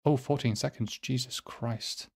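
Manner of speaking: normal talking voice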